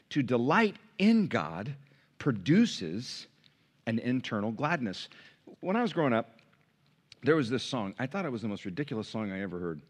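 normal talking voice

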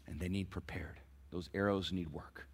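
breathy whispered emphasis. Acted. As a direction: lean in and whisper it. Whispered sermon point.

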